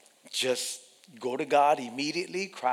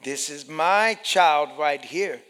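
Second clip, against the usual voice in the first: loud, firm claim, slowing at the end; protective God; 8 dB louder than usual. Acted. God claims his child.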